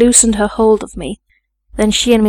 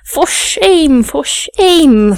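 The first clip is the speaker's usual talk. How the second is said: high, louder cry of reproach; scandalised cry; 6 semitones higher, 4 dB louder, much slower than usual. A narrator's reading. Lady's maid scolds Miss Eyre for striking the young master, scandalised cry. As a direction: cry it out, drawing out SHAME. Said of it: "cried the lady's maid".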